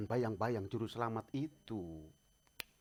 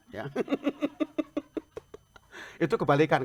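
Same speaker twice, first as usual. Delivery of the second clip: usual speaking voice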